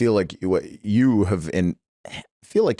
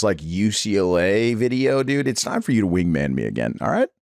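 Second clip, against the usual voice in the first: ordinary talk, nothing marked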